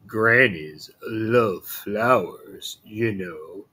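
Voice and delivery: slow, coaxing wolf; a cunning wolf luring her, drawn out; sly wolf voice. Acted.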